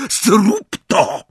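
high, loud, furious screaming; screamed order. A narrator's reading. Bellatrix overrules sister about the danger, screaming. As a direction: scream at her in exasperation. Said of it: "wrzasnęła Bellatrix".

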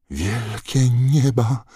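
slow, high, loud groan of dismay; horrified moan. A narrator's reading. McGonagall seeing Crouch alive, horrified moan. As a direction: cry it out in shock. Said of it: "jęknęła profesor McGonagall".

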